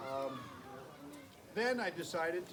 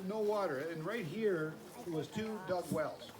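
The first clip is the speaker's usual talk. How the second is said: usual speaking voice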